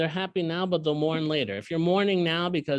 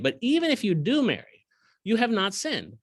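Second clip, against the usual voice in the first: lifted, higher reading voice; scripture reading; 9 semitones higher, 3 dB louder than usual. Acted. Teacher reading the verse to his students, raised pitch. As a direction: proclaim the verse.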